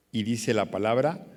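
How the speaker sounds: usual speaking voice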